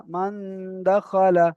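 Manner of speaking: ordinary talk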